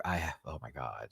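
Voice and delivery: normal talking voice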